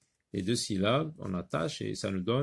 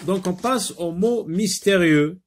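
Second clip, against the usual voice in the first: higher, louder teasing announcement; lively announcement; 8 semitones higher, 7 dB louder than usual. Shown as a demonstration. Teacher announcing the mystery words game, lively and raised. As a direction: hook the class with the mystery words.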